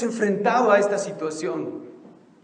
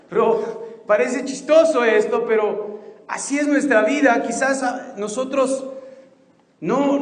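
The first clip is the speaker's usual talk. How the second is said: high, loud preaching to the congregation; fervent preaching; 7 semitones higher, 4 dB louder than usual. Acted. Pastor urging the church not to fear, fervent preaching. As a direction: preach it with full force.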